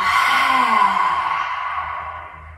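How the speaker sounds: long open exhale with tongue out; lion's breath sigh. Shown as a demonstration. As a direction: breathe it all out in one big sigh. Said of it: "and then let it go".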